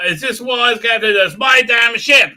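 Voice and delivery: loud, slowed indignant captain; hammed-up captain's outburst; mock angry captain. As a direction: bark it as a captain defending his ship.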